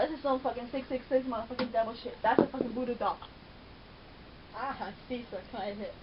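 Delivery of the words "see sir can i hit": ordinary delivery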